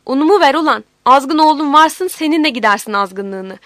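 very loud, raging demand; angry shout. A narrator's reading. Woman demanding her flour back in a quarrel, shouting angrily.

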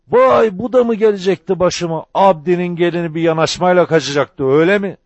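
high, outraged shouting; furious high-pitched tirade; thundering rage. A narrator's reading. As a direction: rage at the insult at full pitch. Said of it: "Abdi Ağa gürledi".

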